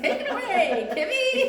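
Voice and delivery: high, loud mock newscaster; news anchor voice. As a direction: ham up the TV anchor hand-off. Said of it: "we sounded like a news anchor there".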